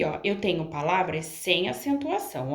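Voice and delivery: ordinary talk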